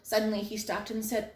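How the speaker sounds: usual speaking voice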